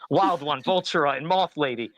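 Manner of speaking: ordinary delivery, nothing marked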